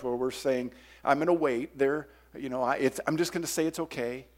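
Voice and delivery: soft, low closing prayer. Acted. Pastor leading the congregation in prayer over music. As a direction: lead the church in prayer.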